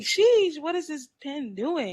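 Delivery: high, loud amazed sheesh; excited exclaim. Retold or imitated. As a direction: blurt it out amazed and laughing.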